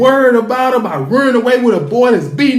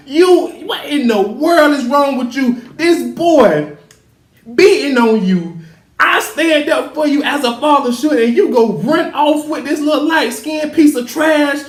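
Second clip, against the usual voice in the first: higher-pitched, indignant fatherly tirade; acted outraged scolding; 6 semitones higher than usual. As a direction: scold in mock outrage, voice pushed up. Acting out father scolding his daughter.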